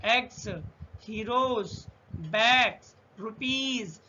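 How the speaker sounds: एग्स, हीरोज, बैग्स, रुपीज with voiced endings; एग्स, हीरोज, बैग्स, रुपीज spaced out, buzzing final sound. Shown as a demonstration. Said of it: "उसका उच्चारण जा होगा".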